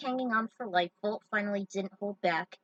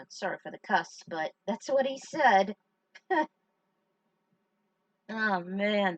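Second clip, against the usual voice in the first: ordinary talk, nothing marked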